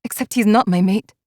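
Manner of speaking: usual speaking voice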